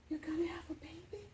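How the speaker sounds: choked, whispered disbelief; stunned mother whispering; tearful whisper. Retold or imitated. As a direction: barely get the words out through tears. Mom after crying three days.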